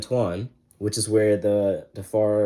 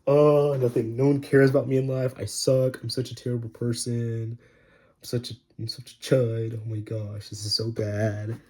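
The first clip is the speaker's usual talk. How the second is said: whiny self-pity; 2 semitones higher than usual. Retold or imitated. Sydney whining self-pity.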